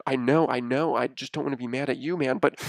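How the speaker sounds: ordinary talk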